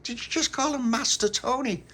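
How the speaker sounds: put-on high-pitched, disbelieving child; incredulous. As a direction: query it in high-voiced disbelief. Child incredulous at friend's words.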